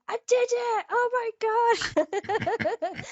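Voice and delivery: high-pitched, drawn-out squeal of triumph; excited scream. Retold or imitated. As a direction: re-enact squealing with disbelief at great news. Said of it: "Yeah, I screamed".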